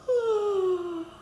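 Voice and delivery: high drawn-out yawn; big yawn. Shown as a demonstration. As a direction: yawn loudly and long. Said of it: "a great big yawn".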